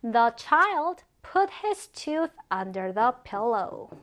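very slow careful English sentence; slow example reading. Shown as a demonstration. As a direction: read slowly so students can repeat. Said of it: "그 아이는 이를 베개 아래에 놓았다". (천천히 예문 읽기)

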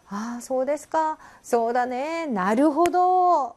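slow, drawn-out listener responses; eager nodding along. Shown as a demonstration. A Japanese listener agreeing constantly, drawn out. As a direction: keep encouraging the speaker. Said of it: "계속 맞장구를 치는 거야".

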